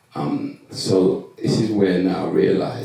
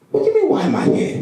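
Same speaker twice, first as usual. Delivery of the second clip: a much higher, fast, laughing disbelief; replaying his own stunned comeback, pitched way up; incredulous retort; 4 dB louder, faster than usual. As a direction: throw the question back half-laughing. Retold clash with counsellor.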